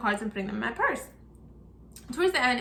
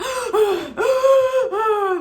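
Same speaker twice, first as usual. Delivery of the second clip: loud, high crying gasp; sobbing gasp; 10 semitones higher, 8 dB louder than usual. Retold or imitated. Showing how she cries at the proposal, a loud sobbing gasp. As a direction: let out a big ugly-crier gasp. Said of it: "I, like".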